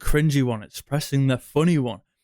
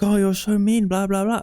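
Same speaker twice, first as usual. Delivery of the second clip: much higher mock-whiny girl voice; mock girl complaining; 8 semitones higher than usual. Girl grumbling about the mean guy, high mock whine. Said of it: "And then she'll be like".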